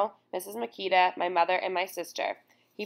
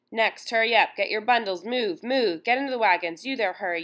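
harsh guard yelling at the crowd; barked orders; 3 semitones higher, 4 dB louder than usual. Acted. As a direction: shove them along with loud orders.

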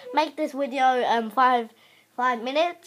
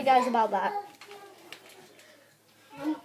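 usual speaking voice

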